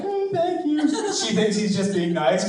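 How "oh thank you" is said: high, light and polite; sweet cashier. Retold or imitated. The cashier answering the old man, sweetly high. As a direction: thank him brightly, none the wiser. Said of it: "she's like".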